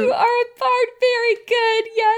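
very high, gushing mock-proud voice. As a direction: praise the player in a high, exaggeratedly proud voice. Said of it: "Can you hear my proud D M voice?".

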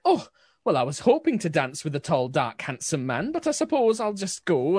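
put-on gnomish old woman, pitch swooping high; huffy old lady. Old servant dismissed from dancing with the handsome man, huffy swooping voice. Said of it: "little gnomish old lady kind of looks, she kind of huffs".

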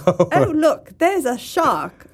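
high, slow fussy droid in Australian; hammed-up Australian robot butler; C-3PO. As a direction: play a prim robot spotting trouble, Aussie accent. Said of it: "It's actually just Anthony Daniels saying".

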